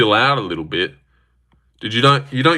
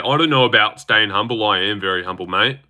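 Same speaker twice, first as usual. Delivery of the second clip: ordinary talk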